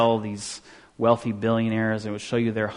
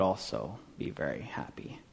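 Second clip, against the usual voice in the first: whispering; 5 semitones lower, 13 dB quieter than usual. Acted. Whispered aside.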